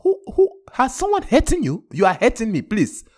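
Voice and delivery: put-on high, alarmed West African accent; a protective West African father demanding answers; West African dad. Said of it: "my pops is straight alpha male West African".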